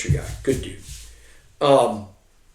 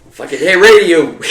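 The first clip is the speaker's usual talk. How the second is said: higher and louder, laughing through the call; 6 semitones higher, 10 dB louder than usual. Retold or imitated. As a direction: call his nickname out while cracking up. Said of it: "He's like".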